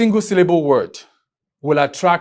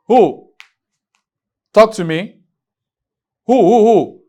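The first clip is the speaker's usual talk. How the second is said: loud, higher prompting of a class; calling out; 4 semitones higher, 4 dB louder, slower than usual. Real, not acted. Teacher pressing students for another example, calling out loudly. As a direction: call out for an answer, pitched up.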